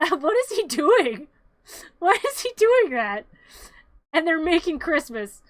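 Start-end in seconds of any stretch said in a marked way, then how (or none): 0.0-3.2 higher and louder, laughing as she asks; amused disbelief. Real, not acted. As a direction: laugh at the absurd clown. Laughing at the clown scene.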